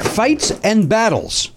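slow game show announcement; drawn out topic reveal; announcer voice. Acted.

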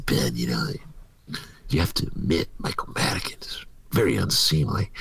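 half-whispered mock reproach; low, confidential scolding; hushed disapproval. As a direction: imitate a scandalized confidant. Voicing disapproving North Side crowd.

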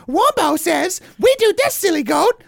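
high squeaky puppet voice; Warmbo. Retold or imitated. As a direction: squeak like an Elmo-style puppet. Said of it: "Some More News' Warmbo into a chipmunk".

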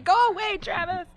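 high, loud comic wail; mock near-crying. Rider shooing off the teammate who moved her, mock-tearful. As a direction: wail it way up high, playfully overwhelmed. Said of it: "make me cry".

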